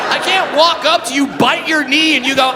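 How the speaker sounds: ordinary talk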